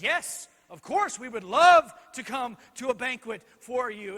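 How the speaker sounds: gladly accept, lightly playing the smitten king; eager doting king. King accepting the queen's banquet invitation, eager.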